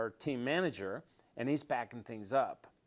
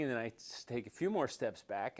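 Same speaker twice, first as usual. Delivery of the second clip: ordinary delivery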